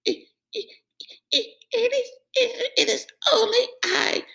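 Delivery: high, stuttering frightened goat; terrified stammer. Acted. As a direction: shake through every word. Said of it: "shook so hard".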